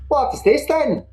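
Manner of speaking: put-on wrong Svirfneblin accent. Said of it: "that's not my accent for Svirfneblin".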